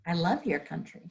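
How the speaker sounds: ordinary talk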